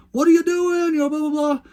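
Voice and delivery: very high, loud mock yell; yelling at brother. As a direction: imitate a buyer screaming at his brother. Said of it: "he just starts yelling at his brother".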